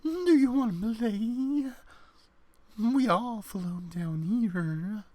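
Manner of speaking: a hint of the sinister clown luring a child. Impression of the clown from It luring a child, lightly creepy. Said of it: "can do the clown from Stephen King's It".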